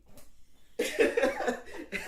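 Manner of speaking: high, loud burst of laughing; real laughter. Real, not acted. Teller recalling the secret handover, laughing out loud. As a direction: crack up mid-story.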